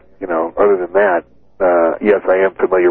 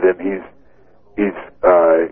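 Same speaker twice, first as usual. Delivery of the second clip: normal talking voice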